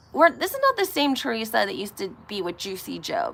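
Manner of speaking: ordinary talk, nothing marked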